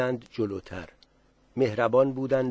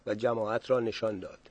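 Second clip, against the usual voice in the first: plain reading voice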